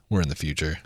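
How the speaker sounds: very soft, low aside; thinking to oneself. Acted. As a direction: say it hushed, like a private thought. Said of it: "you think to yourself".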